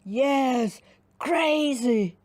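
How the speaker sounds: loud firm giant voice. Acted. Redbeard firmly answers the gnomes. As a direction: plant your feet and say it. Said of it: "said Redbeard firmly".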